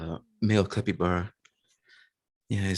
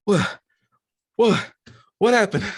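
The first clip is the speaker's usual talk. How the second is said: loud, high-pitched startled questions; bewildered alarm; 9 dB louder than usual. Acted. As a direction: blurt it out in shocked confusion. Revived capybara confused, alarmed.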